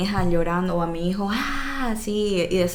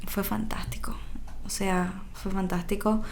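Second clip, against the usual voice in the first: soft relieved whisper; 6 dB quieter than usual. Real, not acted. Mother whispering her relief about her son. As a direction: hush it out in wonder.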